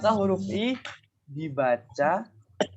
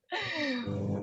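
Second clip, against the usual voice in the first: embarrassed laugh; 11 semitones higher, 8 dB quieter than usual. Real, not acted. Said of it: "maaf, huruf".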